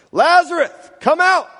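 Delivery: high-pitched commanding call; loud calling out. A narrator's reading. Jesus summoning dead Lazarus out of the tomb, calling out. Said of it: "called out in a loud voice".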